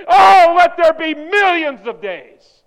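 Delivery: booming, drawn-out divine proclamation; voice of God. Acted. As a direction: call creation into being, loud and high. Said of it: "he said".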